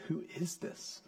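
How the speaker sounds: near-whispered, awed question; soft, breath-led wondering; hushed awe. Acted. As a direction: ask it very softly, in wonder. Awed disciples' question, quoted.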